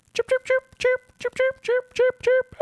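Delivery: high chirping like birds; mimicking birds at dawn; bird chirps. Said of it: "every bird wakes up".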